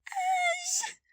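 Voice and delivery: very high, loud tearful cry; called out. A narrator's reading. Evelyn running to her brother, tearful cry. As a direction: cry his name in relief. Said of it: "Evelyn called".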